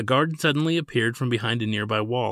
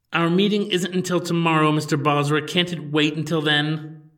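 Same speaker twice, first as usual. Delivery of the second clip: higher, louder, slowing with annoyance; annoyed; 6 semitones higher, 4 dB louder than usual. Acted. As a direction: let the irritation show. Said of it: "Her tone relayed a true sense of annoyance".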